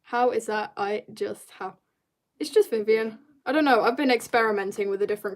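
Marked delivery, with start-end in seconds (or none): none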